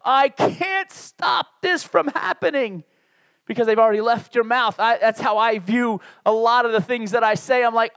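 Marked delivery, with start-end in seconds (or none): 0.0-2.8 slow, high, drawn-out slow-mo voice; slow-motion cry. Acted. Preacher mimics watching his words escape like a train wreck, slow-motion cry. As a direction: stretch it out like a helpless slow-motion yell. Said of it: "it's like a slow mo-, motion train wreck".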